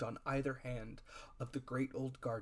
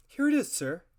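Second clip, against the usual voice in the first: high, loud and eager; young Jim pipes up keenly; eager boy; 11 semitones higher, 9 dB louder than usual. A narrator's reading. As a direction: Jim hands the packet over in an eager, high voice. Jim eagerly hands over packet.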